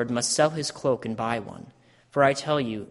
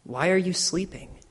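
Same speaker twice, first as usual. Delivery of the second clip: reproach; 7 semitones higher than usual. Acted. Jesus finds disciples asleep.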